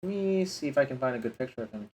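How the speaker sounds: usual speaking voice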